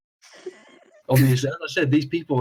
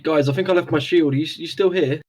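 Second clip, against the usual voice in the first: shouting upstairs; 5 semitones higher, 4 dB louder than usual. Acted. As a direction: call up to the floor above, louder. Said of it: "I'd just like to shout up".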